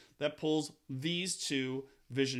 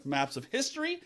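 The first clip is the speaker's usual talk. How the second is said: usual speaking voice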